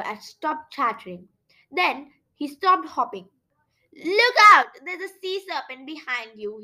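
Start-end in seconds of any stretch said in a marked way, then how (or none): 3.9-4.7 sudden high, loud shout; cry of warning. A narrator's reading. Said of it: "he cried". 4.8-6.6 urgent warning, softer after the shout; frightened warning. A narrator's reading. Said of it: "he cried".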